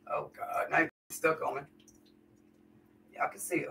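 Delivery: ordinary talk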